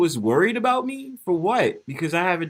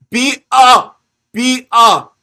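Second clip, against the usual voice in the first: loud, high hype call to the chat; shouting a greeting to new people in the chat; 7 semitones higher, 13 dB louder, much slower than usual. Real, not acted. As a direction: pump up the new viewers with a yell. Hyping up new chat viewers.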